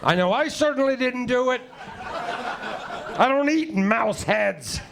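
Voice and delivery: high, slow mock-scolding voice talking to the cat. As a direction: nag the cat in a shrill put-on voice. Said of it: "talking out loud at home to my cat".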